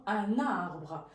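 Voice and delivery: Un arbre said as un-narbre; liaison with N. Shown as a demonstration. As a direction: glide the N of Un into arbre. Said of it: "c'est comme s'il y a un N".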